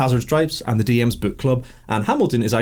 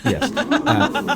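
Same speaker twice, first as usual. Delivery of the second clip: laughing. Real, not acted. Host laughing mid-intro.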